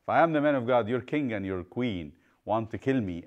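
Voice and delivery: ordinary delivery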